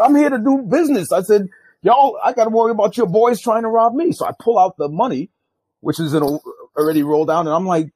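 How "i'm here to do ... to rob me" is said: high, loud retold insistence; agitated storyteller quoting himself; indignant protest. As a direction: stand your ground, voice raised high. Protests to the gunmen.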